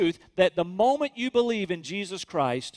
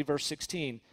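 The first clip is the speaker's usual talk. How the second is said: normal talking voice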